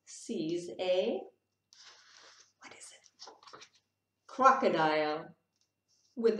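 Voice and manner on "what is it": whispered aside; softly wondering aloud. Real, not acted. Reading picture book to child.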